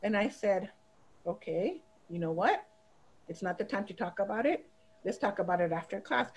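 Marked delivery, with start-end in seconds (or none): 1.2-6.3 low, soft, measured reply; calm teacher. Retold or imitated. Teacher putting off a yelling student until after class, calm. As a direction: calmly put off an argument, voice lowered.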